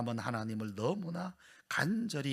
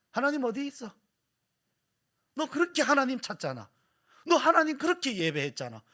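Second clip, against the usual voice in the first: higher, louder sneering taunt; swaggering mockers; 11 semitones higher, 6 dB louder than usual. Enemies taunting the sufferer about his God, swaggering mockery. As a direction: jeer at him like a gloating enemy. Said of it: "와가지고 다 거들먹거리면서".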